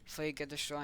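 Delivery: usual speaking voice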